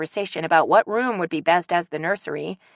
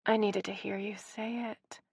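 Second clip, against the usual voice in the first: much softer, hushed confession; barely above a whisper; 4 semitones higher, 12 dB quieter than usual. A narrator's reading. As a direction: breathe it out just above a whisper. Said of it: "barely above a whisper".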